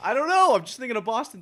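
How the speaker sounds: loud. Pretend. Loud burst while trying Boston.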